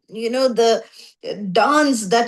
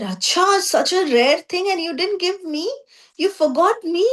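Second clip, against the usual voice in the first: higher, half-hurt voice of a friend left out; hurt complaint; 7 semitones higher than usual. Retold or imitated. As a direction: sound wounded at being forgotten, voice raised. Shiva complains he was forgotten.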